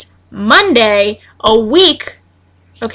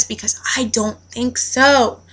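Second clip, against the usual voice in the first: whispered aside; 6 dB quieter than usual. Real, not acted. Aside after ranting.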